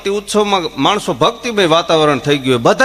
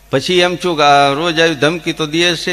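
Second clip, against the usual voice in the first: ordinary talk, nothing marked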